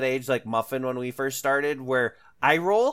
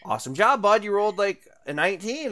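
put-on lightly upbeat praise for a kid; enthusiastic praise; 7 semitones higher than usual. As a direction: cheer the kid on, a bit higher. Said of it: "sometimes I'm like".

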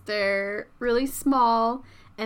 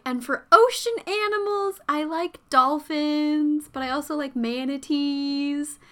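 mom imitation; 5 semitones higher, 4 dB louder than usual. Mom quoted, high voice.

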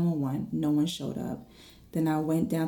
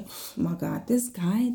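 usual speaking voice

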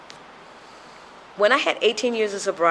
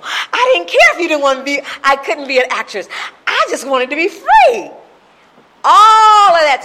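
much higher and louder, ringing with release; passionate outcry; 9 semitones higher, 10 dB louder than usual. Real, not acted. Telling the group she's finally free, in a passionate outcry. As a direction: cry out your freedom. Testimony climax about freedom.

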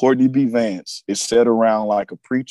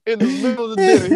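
laughing; 12 semitones higher, faster than usual. Real, not acted. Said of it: "laughing while telling".

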